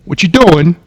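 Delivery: put-on high, loud New York accent; indignant squirrel with a New York accent; New Yorker squirrel. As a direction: squawk it like an annoyed New Yorker. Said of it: "squirrel that talked like a New Yorker".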